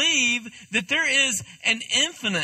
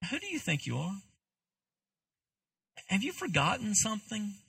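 softly put, gentle questioning; gentle rebuke; 7 dB quieter than usual. Acted. Preacher voices Paul looking at us, gently rebuking. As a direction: chide gently, dropping the volume. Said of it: "and I think gently".

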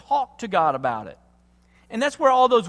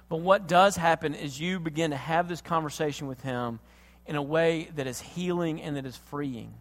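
usual speaking voice

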